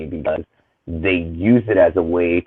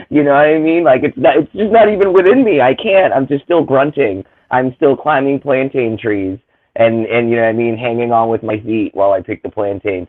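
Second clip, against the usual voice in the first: sarcastic mock self-pity; 6 semitones higher, 5 dB louder than usual. Sarcastic mock of racist view.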